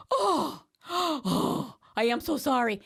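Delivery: high, flustered apology; upset mom. Retold or imitated. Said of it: "your mother got so upset".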